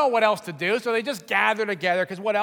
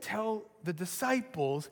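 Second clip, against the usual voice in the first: hushed message; 5 semitones lower, 10 dB quieter, slower than usual. Retold or imitated. The angel's message retold softly.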